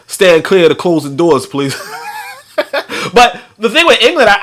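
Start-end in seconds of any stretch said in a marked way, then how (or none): 0.1-1.7 looser, livelier train announcement; imitated conductor, casual and amused.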